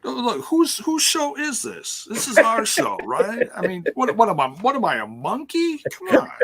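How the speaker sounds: high indignant protest; mock outrage. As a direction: play it hammy and offended. Mock outrage at being tested.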